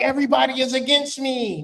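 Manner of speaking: mock whine. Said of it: "And you like".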